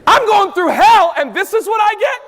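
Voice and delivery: high, loud indignant yell; hammed-up outrage at full volume; outraged protest. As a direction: shout it in mock disbelief. Acted outraged reply to calm verse.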